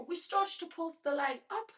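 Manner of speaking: put-on slipping into a British accent mid-cue; a hint of her British father's accent, laughing. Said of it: "God, my British father".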